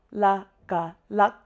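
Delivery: "La, ga, lak" in three separate beats; step-by-step oral spelling. Shown as a demonstration. Teacher showing starter and finishing sound, recited step by step. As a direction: say "La", then "ga", then join them as "lak". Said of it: "say those two and then we say the product".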